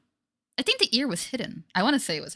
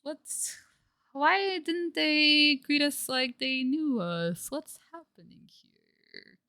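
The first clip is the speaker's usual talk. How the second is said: mock confusion; 7 semitones higher, much slower than usual. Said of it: "It's like".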